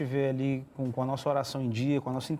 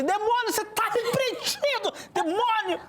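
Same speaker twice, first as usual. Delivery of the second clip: high, drawn-out mock scream at a demon; hammed-up rebuke yell; slower than usual. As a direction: cry out a comic exorcism, much higher and laughing. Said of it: "Ou você grita assim". (grito agudo de repreensão, de brincadeira)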